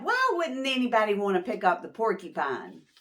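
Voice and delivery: plain reading voice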